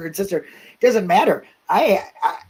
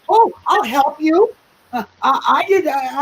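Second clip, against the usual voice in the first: higher, slower, falsely eager offer to help; mock helpful suspect; 7 semitones higher than usual. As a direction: play the guilty guy who is lightly faking innocence.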